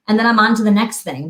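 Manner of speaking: ordinary delivery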